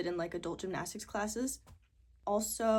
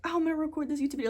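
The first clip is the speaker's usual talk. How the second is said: higher, louder, rapid-fire excitement; eager thought; 7 semitones higher, 5 dB louder, much faster than usual. Retold or imitated. Vlogger voices own after-work thought about filming, eagerly. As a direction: blurt it out like an eager plan.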